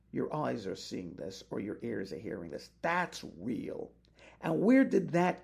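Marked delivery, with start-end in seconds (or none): none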